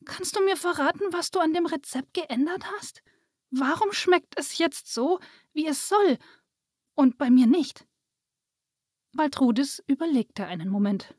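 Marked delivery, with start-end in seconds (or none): 0.0-7.9 put-on high, mildly pleading child. A narrator's reading. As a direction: coax the secret out, pitched high. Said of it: "sah die kleine Fee bittend an". (leicht bittend, mit hoher Mädchenstimme)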